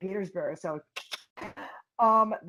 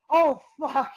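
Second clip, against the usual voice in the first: higher, louder startled oh fuck; startled curse; 6 semitones higher, 8 dB louder than usual. Retold or imitated. Knocked down by her opponent, startled curse. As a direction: yelp it as you hit the mat. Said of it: "I'm like".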